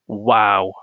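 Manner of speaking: low, unimpressed sarcasm; sarcastic wow. Real, not acted. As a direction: say wow with dry disbelief at how bad it is. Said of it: "That sounds quite a sarcastic one".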